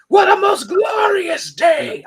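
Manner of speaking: loud, slow booming ape voice; Caesar ape voice. Retold or imitated. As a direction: bellow it like a regal ape. Quoting Caesar from trailer.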